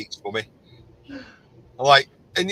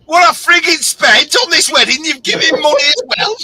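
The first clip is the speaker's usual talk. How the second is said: loud, much higher outraged yell; outraged shout; 13 semitones higher, 9 dB louder than usual. Retold or imitated. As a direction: shout in disbelief at the wife. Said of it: "I said".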